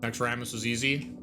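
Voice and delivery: ordinary delivery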